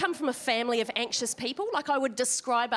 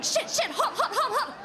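high frantic yelps, much higher; panicked lizard; 12 semitones higher than usual. Acted. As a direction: hop about yelping on hot sand. Panicked lizard on hot sand.

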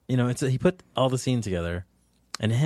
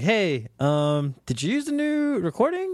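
high, careful mock-innocent question; feeling him out, retold; tentative query; 8 semitones higher than usual. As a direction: ask hesitantly, pitch climbing high at the end. Retelling his suspicious text to Jonathan.

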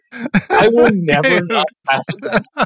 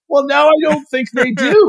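mock offended reply; 9 semitones higher, 3 dB louder than usual. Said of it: "be like".

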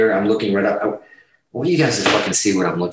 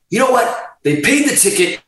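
imitating Paul; 10 semitones higher than usual. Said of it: "And Paul's like".

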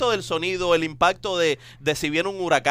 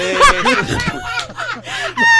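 loud high burst of laughter; 7 dB louder than usual. Real, not acted. Host cracking up at own closet joke, loud laughter.

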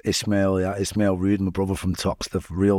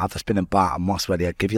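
usual speaking voice

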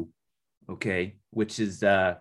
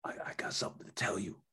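breathy secret whisper; 3 semitones lower, 11 dB quieter than usual. Acted.

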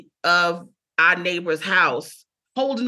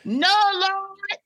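high, wailing church-lady cry of alarm; hammed-up panicked wailing to the Lord; theatrical grandmother; 9 semitones higher, slower than usual. Grandmother on the late-night phone, wailing high in theatrical alarm. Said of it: "my grandmother's very theatrical".

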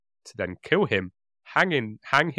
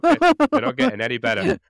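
excited squeal; 7 dB louder than usual. Real, not acted.

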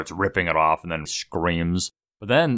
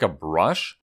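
ordinary delivery

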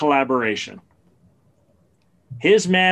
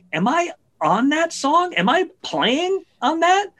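puzzled [name]; 13 semitones higher than usual. Retold or imitated. [name] puzzled, calling [name].

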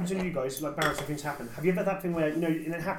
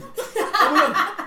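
loud high laughing oh my God; laughing exclamation; 13 semitones higher, 10 dB louder, much slower than usual. Real, not acted. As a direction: burst out laughing with it. Laughing at the name joke.

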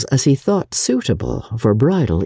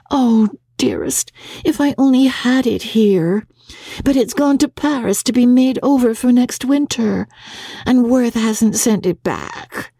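put-on raised young woman's voice, slowing at the end; a pretty lament over the lost dress; May, lamenting; 10 semitones higher than usual. Young wife mourns her wedding dress sent to Paris.

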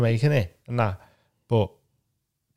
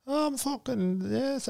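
high, unintended Irish lilt on a self-cheer; accidental Irish accent on a quiet triumphant yes; 13 semitones higher, 7 dB quieter, slower than usual. Acted. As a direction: slip into a soft Irish accent, pitched way up. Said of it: "the best Irish accent you've ever done".

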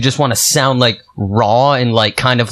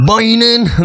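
twangy [name]; 7 semitones higher than usual. Retold or imitated. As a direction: bark out a hammed-up country twang. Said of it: "it's just like".

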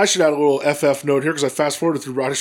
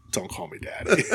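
breathy whispered retort, much softer; imitated whisper; 3 semitones higher, 7 dB quieter than usual. Host retelling the reply to Mariah, whispered. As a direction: whisper it like a quick private correction. Retelling a hushed on-air exchange.

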